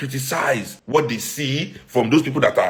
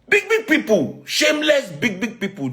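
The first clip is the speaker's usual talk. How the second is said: rising outrage; 10 semitones higher than usual. Real, not acted. Building outrage at big people.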